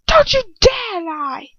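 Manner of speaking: high, loud, slow shouted threat; furious shout. A narrator's reading. Bellatrix shouting at the lying captive, furious. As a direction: shout her down in fury. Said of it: "she shouts".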